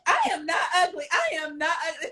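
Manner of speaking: high-pitched, mildly indignant denial; shocked protest. Retold or imitated. Girl denying she's ugly to her teaser, mock shocked. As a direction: act out shocked disbelief, half laughing. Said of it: "like, shocked. They be like".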